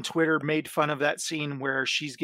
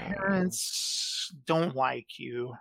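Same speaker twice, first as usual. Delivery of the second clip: Palpatine; 2 semitones lower, 5 dB quieter, much slower than usual. Pretend. Palpatine telling Vader he was left out, slow mock villain voice. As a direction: draw it out slowly like a sinister emperor. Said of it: "or Palpatine let it happen".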